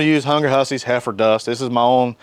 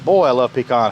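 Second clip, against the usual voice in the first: normal talking voice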